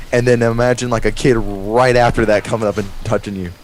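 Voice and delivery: ordinary delivery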